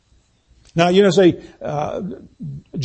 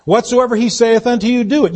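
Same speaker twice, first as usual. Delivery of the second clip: raised preaching voice on scripture; 4 semitones higher than usual. Acted. Pastor proclaiming scripture to the congregation, raised. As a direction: proclaim it from the pulpit.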